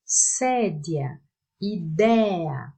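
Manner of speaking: "Sédia, idea" stressed on the first E, not the end; stress not on last. Shown as a demonstration. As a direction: lean on the E in "Sédia". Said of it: "não é na última". (sílaba forte não é a última em "Sédia")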